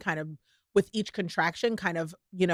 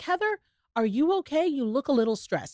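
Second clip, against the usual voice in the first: higher concerned question; 6 semitones higher than usual. Retold or imitated. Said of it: "I was like".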